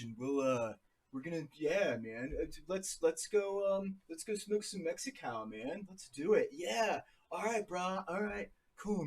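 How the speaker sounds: soft, laid-back surfer dude drawl; mock stoner surfer, lazy and mellow; California surfer. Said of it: "California is like".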